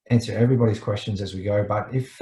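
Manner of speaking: ordinary talk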